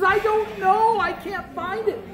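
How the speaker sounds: high-pitched mock-upset child; crying boy. A narrator's reading. Shepherd boy answering about the lamb, high mock-tearful. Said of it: "and he was crying".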